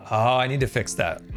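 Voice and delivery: ordinary delivery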